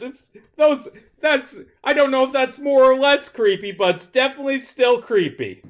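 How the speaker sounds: high, squirmy disgust; creeped out. Real, not acted. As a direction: sound thoroughly creeped out. Creeped out by puppets.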